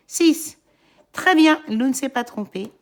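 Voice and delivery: loud, high cheer; kids show host. Acted. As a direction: praise the child with a burst. Cheering the child's right answer. (félicitation forte et aiguë)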